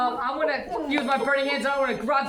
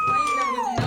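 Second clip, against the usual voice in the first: excited shout. Real, not acted. Gloating over the won fight.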